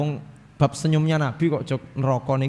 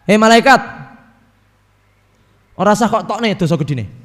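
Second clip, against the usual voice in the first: loud call to the angel; commanding call; 3 semitones higher, 6 dB louder than usual. Acted. Allah loudly commanding the angel to stop.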